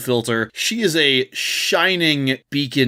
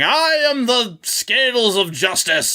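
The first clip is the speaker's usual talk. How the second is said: higher, slowed, grandly unhinged declaration; lightly hammed-up mad villain proclaiming; maniacal villain; 9 semitones higher than usual. Blinded bullet farmer roaming around, ranting maniacally. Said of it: "maniacally going".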